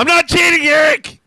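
high, loud yell; shouting. Pretend. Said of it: "gonna wake up in the middle of the night yelling that".

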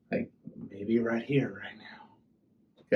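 slow, hushed, fading to a whisper; dramatic whisper. Acted. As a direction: lean in and whisper it. Dramatic hush, whisper heard.

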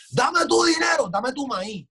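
high, loud demanding yell; hammed-up bossy shout; mock demand. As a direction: demand the corn like a greedy shaman. Voicing shaman demanding tribute.

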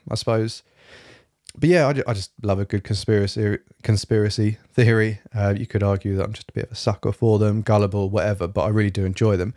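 usual speaking voice

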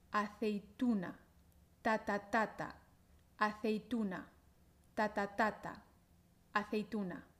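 aceituna with the third syllable tu stressed, ta ta TA ta. Shown as a demonstration. Teacher taps the stress rhythm of aceituna for the student. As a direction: tap out ta ta TA ta, then aceituna with tu stressed. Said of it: "La sílaba fuerte es tu".

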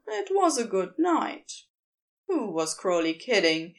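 ordinary delivery, nothing marked

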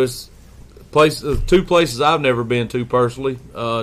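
ordinary talk, nothing marked

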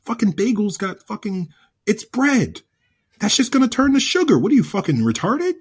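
high, incredulous rant; retold exasperation. Husband argues with wife about bagels, high exasperated retelling.

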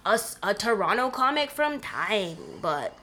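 put-on Toronto slang, laid-back Scarborough accent. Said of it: "in my Scarborough accent".

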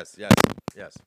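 blasting yes yes yes; loud yes. Real, not acted. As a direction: hammer it out loud. Emphatic mock agreement.

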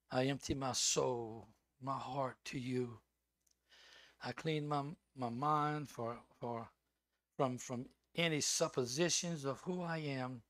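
low, soft, slow prayer voice; a preacher opening his sermon with prayer. Acted. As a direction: pray quietly and humbly. Begins praying.

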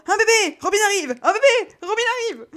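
cris haut perchés, Un bébé répété en boucle; affolé et excité. Acted. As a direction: panique joyeuse, il court partout. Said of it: "courait partout en répétant". (Alistair court partout en annonçant l'arrivée du bébé)

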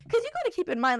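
ordinary talk

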